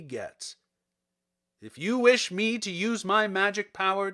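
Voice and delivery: booming wizard Oz. Acted. The great head sets Dorothy's price, loud and commanding. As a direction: lay down the terms like an all-powerful wizard.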